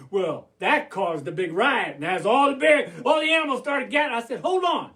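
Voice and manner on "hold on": calling a halt. Acted. Halting the rioting animals.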